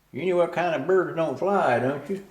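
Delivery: lightly voiced landlady setting up a joke; teasing landlady. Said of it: "she says".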